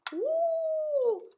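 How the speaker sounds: high loud mock wind; playful storm noise, high and loud; howling wind whoop. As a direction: whoop like a gust.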